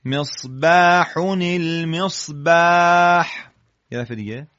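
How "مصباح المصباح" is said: loud, slow, higher مصباح المصباح with long "baa"; model recitation. Shown as a demonstration. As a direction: stretch each "baah" and link "misbaahunil-misbaah". Teacher models the student's phrase.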